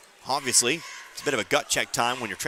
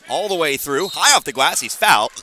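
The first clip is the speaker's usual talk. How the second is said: excited call; 2 semitones higher, 7 dB louder than usual. Real, not acted. Play-by-play of a fast break.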